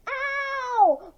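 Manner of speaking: high, sharp cry of pain; pained yelp. Acted. Greg crying out as Mara's hand hits his nose. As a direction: yelp as the knuckles hit.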